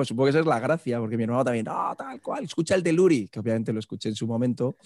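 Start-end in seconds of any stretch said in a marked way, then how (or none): 1.7-3.3 quoted brother. Retold or imitated.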